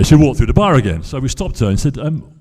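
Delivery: ordinary talk, nothing marked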